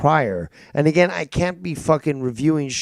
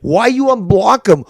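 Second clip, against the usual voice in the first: louder and higher, accusing; angry demand; 5 semitones higher, 5 dB louder than usual. Real, not acted. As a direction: shout the accusation. Host yelling at caller.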